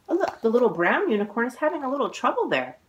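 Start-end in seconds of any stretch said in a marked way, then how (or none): none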